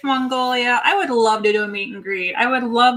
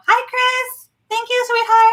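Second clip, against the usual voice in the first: high, sing-song sweet hello; sweet greeting; 11 semitones higher, 3 dB louder, slower than usual. Real, not acted. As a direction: coo it to a favorite viewer. Sweet shoutouts to chat viewers.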